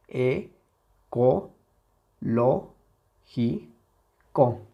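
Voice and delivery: single syllables with pauses between; sílaba por sílaba. Shown as a demonstration. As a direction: spell the word out one syllable at a time. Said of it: "La separamos en sus vocales". (separando las sílabas)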